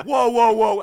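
high, loud whoa-whoa; alarmed whoa. Retold or imitated. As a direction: back him off loudly. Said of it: "And I'm like".

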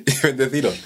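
hammed-up holy saviour, high and laughing; mock Jesus blessing. Carried by the crowd, he plays Jesus offering his body, laughing. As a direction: bless the crowd like a joking messiah.

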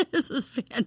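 words through laughter; giggling while talking; laughing. Real, not acted. Laughing at partner's hiccups.

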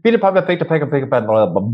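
high, rattled-off tongue twister sliding down into babble; tense tongue twister. Shown as a demonstration. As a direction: race through it with tension in the voice. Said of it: "then you create a lot of tension in your voice".

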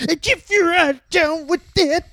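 high yelled promo catchphrase; wrestling promo voice. Retold or imitated. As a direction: hype up the crowd. DX promo quoted.

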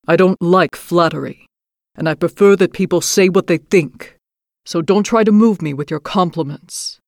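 a growling, terrible beast; grotesque monster voice. A narrator's reading. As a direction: speak as a stern monster. Beast speaks.

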